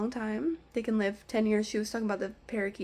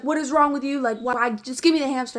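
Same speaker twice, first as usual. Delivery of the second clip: louder imitation of an angry customer yelling; retold yelling; 4 semitones higher, 8 dB louder than usual. Angry customer yelling at the pet store clerk for a hamster, loud mock yell. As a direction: throw the words out as a loud, exasperated demand.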